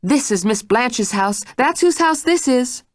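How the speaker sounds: firm rebuke. Acted. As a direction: put her firmly in her place. Mrs Stitt asserts.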